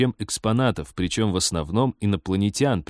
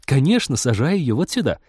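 high, sweetly surprised greeting; cooing woman; 6 semitones higher than usual. A narrator's reading. Girl cooing over a cage greets pilot, high and delighted. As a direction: pitch it up, delighted to see visitors. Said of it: "Сюсюкающая над выставочной клеткой Рит".

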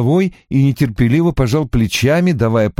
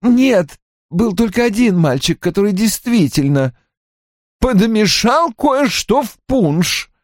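high, irritated correction, not shouted; mildly snappish, high character voice; barking retort; 11 semitones higher than usual. A narrator's reading. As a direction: contradict testily, pitched well up. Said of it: "рявкнул Рой".

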